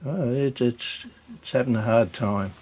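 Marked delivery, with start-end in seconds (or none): none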